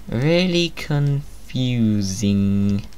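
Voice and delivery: very slow, stretched-out really confusing; drawn-out confusion. Acted. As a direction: drag it out in mock bewilderment. Dragged-out confused aside.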